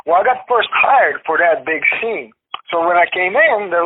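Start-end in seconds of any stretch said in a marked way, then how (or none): none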